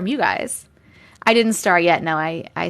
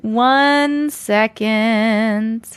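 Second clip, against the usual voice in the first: stretched out; much slower than usual. Real, not acted. Stalling before reading.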